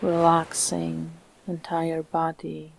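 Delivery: calm meditation voice with long, unhurried pauses; soothing, evenly paced relaxation guidance; guided meditation. Acted. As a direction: guide the listener gently into stillness, phrase by phrase.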